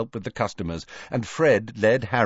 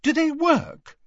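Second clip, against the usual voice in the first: high-pitched doubtful question; skeptical. A narrator's reading. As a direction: question it with raised-eyebrow doubt. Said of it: "raised an eyebrow skeptically".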